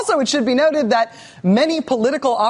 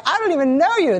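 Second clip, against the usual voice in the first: higher, pretend stranger brushing off an acquaintance; mock snub; 7 semitones higher than usual. Host voicing Romney and Cheney as strangers, mock snub. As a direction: play Romney snubbing Cheney on the street. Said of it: "and it's like".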